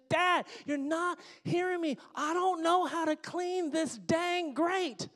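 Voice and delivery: high, loud, slow drawn-out shriek; daughter yelling. Retold or imitated. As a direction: scream it like a furious kid at her limit. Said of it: "She said".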